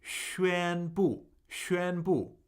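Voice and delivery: 宣 held high and level, 布 falling sharply; tone demonstration. Shown as a demonstration. Teacher modelling "to declare", 宣布 level then falling. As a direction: say 宣布 as first tone plus fourth tone. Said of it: "First tone, fourth tone".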